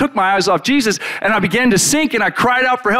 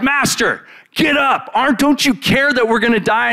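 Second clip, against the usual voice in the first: high, frantic plea to wake him; panicked cry; 7 semitones higher than usual. Retold or imitated. Disciples panic in the storm.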